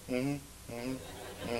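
very soft, low, flat-toned mm-hmms; unexcited wife. Retold or imitated. Husband imitating his calm wife's soft mm-hmms. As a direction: barely react at all. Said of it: "She's just like".

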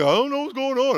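mock bewildered complaint; loud clueless whine. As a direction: sound lost and loud. Mocking clueless person.

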